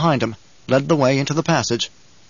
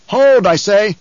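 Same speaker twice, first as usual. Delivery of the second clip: high, loud order to halt; sharp, imperious command; commanding shout; 7 semitones higher, 6 dB louder, slower than usual. A narrator's reading. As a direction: shout down your own men. Duke halts his advancing men.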